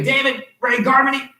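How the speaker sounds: parent's in-trouble name call; mom using three names. Shown as a demonstration. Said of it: "said my name this way".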